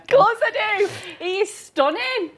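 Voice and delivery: high, emphatic swooning over a crush; gushing protest. Real, not acted. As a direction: burst out, then stretch the praise. Protests that she adores her crush.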